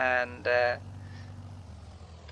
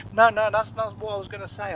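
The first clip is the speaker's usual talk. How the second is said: flustered protest; 9 semitones higher than usual. Retold or imitated. Ray protests the teasing again.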